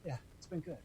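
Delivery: a near-silent, fading last breath of words; whispered farewell at sunset; contented sigh. Retold or imitated. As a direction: let it drift out like a final exhale. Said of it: "I just kinda go".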